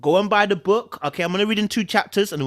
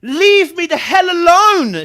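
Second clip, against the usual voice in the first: frightened scream; 10 semitones higher, 10 dB louder than usual. Acted. Sky screaming at the intruder to leave her alone. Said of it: "she wanted to scream".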